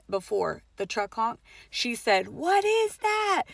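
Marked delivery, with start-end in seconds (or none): 2.3-3.4 high, drawn-out cry of terror; panicked high wail; terrified voice. Retold or imitated. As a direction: imitate a terrified cry, pitched way up. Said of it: "in a terrified voice".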